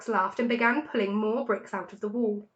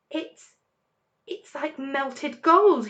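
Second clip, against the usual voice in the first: gasped; 5 semitones higher, 4 dB louder, much slower than usual. A narrator's reading. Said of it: "he gasped".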